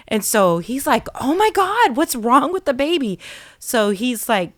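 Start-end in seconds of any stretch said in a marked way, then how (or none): 1.2-3.2 higher, alarmed dad; mock frantic worry; retold alarm. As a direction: panic as a father hearing his baby scream. Dad hears baby screaming.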